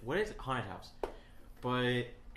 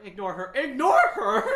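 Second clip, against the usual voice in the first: high, loud laughing repeat; laughing dismissal; 11 semitones higher, 14 dB louder than usual. Retold or imitated. Guide dismissing the frantic guest, laughing. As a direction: brush it off through laughs. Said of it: "And then they're like".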